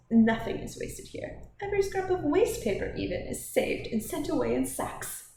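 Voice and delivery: animated matron holding forth; bustling Mrs Inglethorpe. Acted. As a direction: lay down house rules with proud energy. Voices Mrs Inglethorpe instructing her guest.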